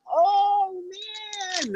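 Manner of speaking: very high, drawn-out imitation voice; Shuby. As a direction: stretch it out in a high put-on voice. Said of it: "That's a good Shuby".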